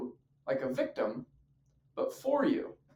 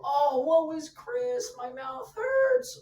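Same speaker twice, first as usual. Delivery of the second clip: very high, slow mock lament; self-pitying wail; slower than usual. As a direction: whine it out like a hammed-up victim. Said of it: "when you play the victim card".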